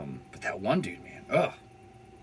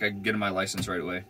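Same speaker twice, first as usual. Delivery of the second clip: ordinary talk, nothing marked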